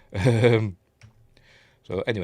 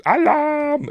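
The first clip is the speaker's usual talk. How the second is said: high loud cry of Alarm; hammed-up guard shouting; mock alarm shout; 7 dB louder than usual. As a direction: raise the alarm like a startled guard.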